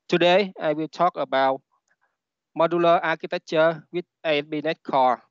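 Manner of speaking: Vietnamese-accented English; non-native English with a Vietnamese accent. Real, not acted. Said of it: "English is not my first language".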